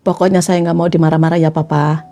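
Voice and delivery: low, composed wife voice; serious insistence. Acted. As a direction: lay down the rule, pitched low. Acts wife addressing husband. (istri menegaskan dengan suara rendah)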